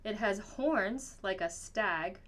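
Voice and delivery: ordinary delivery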